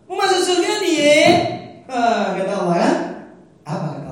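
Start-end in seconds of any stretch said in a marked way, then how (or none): 0.1-1.2 high, loud mocking challenge; voicing God in casual Jakarta slang. Preacher voicing God taunting the congregation, loud mocking challenge. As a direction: shout it like a scornful question to lazy believers. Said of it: "Allah tanya".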